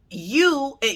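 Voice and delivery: ordinary talk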